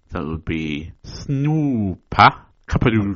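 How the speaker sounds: "Snoopa Kaparu" drawn out and punched in mock gibberish; emphatic gibberish. Shown as a demonstration. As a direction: stress "Snoopa Kaparu" loudly as if proving it is you. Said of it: "If you want to emphasize that it's me".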